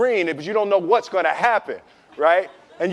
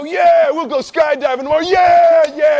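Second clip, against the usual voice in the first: loud, high whooping agreement; drunken hyped shout; 8 semitones higher, 6 dB louder than usual. Retold or imitated. Drunk at the bar shouting yes to his friends, loud and high. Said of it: "And you go".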